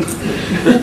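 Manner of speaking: quick breathy laugh at his own cheek; a short unvoiced chuckle. Real, not acted.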